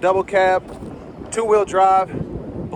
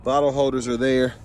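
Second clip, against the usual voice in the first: normal talking voice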